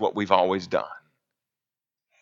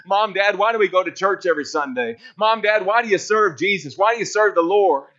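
lightly voiced child, pitched higher; questioning child; 8 semitones higher than usual. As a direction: ask like a kid quizzing Mom and Dad. Voicing children questioning their parents.

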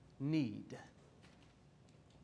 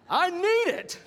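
high, loud mock tantrum; demanding child; 13 semitones higher, 8 dB louder, slower than usual. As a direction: yell it like a kid begging for a toy. Mimics child demanding a toy.

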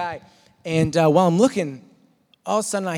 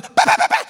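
very high rapid bahs; high mock gunfire; gun noise; 4 dB louder, faster than usual. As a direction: fire it off again. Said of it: "continual pattern of".

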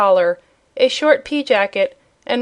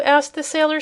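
usual speaking voice

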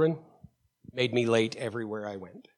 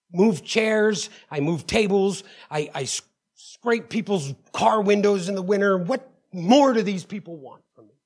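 indignant rant; 7 semitones higher, 5 dB louder than usual. Retold or imitated. Ranting about his good deeds.

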